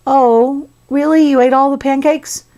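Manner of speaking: high, put-on plaintive voice; mock-worried little complaint; concerned. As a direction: act mildly hurt and let down. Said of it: "Now he's concerned".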